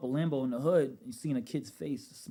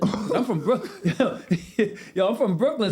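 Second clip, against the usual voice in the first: laughing; 6 semitones higher, 7 dB louder than usual. Real, not acted. Laughing at own naivety.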